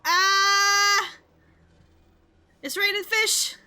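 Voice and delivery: high, loud startled cry; surprised yelp. Real, not acted. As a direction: shriek it out in surprise. Sudden surprise at falling fish.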